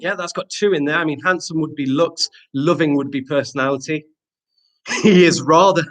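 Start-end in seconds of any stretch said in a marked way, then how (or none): 5.0-5.8 louder, higher gentleman voice. Pretend. Teacher reading a pupil's sentence aloud, as a gentleman. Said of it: "do that in a gentleman voice".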